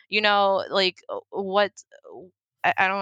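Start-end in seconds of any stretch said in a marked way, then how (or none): none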